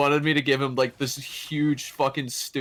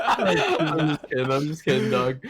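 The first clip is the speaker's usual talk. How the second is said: laughing; 4 semitones higher than usual. Real, not acted. Laughing reassurance after teasing.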